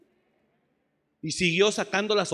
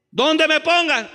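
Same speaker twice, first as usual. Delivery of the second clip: shouted, high and slow; emphatic shout; 11 semitones higher, 9 dB louder, slower than usual. Acted. Preacher shouting back his motto to the church. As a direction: drive it home word by word.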